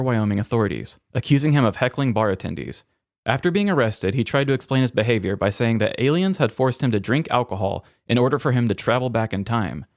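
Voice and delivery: normal talking voice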